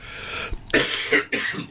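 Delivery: hacking cough; real cough into the mic; coughing fit. Real, not acted. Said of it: "I'm really coughing and spluttering here".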